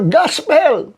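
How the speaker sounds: high-pitched street-preacher call. Shown as a demonstration. Preacher in old times calling out to passers-by, high shout. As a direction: cry the word out to draw a crowd. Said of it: "pag sumigaw ka".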